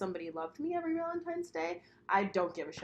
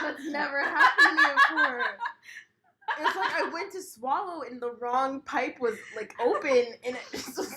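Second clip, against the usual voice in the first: laughing after choking; 5 semitones higher, 3 dB louder than usual. Real, not acted. Just choked on a drink.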